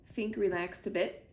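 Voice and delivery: ordinary delivery, nothing marked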